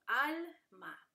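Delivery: "alma" as two separate beats; "alma" said as al, then ma; split into syllables. Shown as a demonstration. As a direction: break "alma" between l and m. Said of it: "se dividen".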